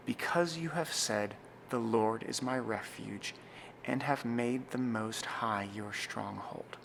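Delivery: slow, pausing at comma and midpoint; solemn, spacious psalm reading; slow liturgical reading. Shown as a demonstration. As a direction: read deliberately for the congregation to follow. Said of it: "I'll read it through a few times again".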